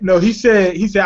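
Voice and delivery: ordinary delivery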